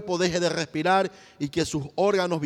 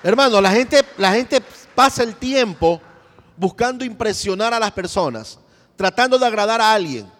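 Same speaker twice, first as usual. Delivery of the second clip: much louder, pressing exhortation; preaching at full force; 3 semitones higher, 8 dB louder than usual. Acted. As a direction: shout the warning to the brethren. Preacher exhorts the congregation loudly. (predicador exhortando a todo volumen)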